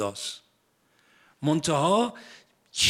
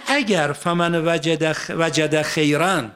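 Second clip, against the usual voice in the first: Quran recitation; 5 dB louder, slower than usual. Acted. Verse recited again.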